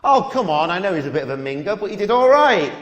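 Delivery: ordinary talk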